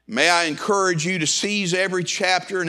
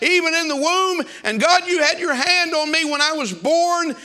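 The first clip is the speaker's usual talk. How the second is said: fiery preaching; 8 semitones higher than usual. Acted. Pastor rousing the congregation with Jeremiah's words, fiery preaching. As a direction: preach it out to the whole church, building.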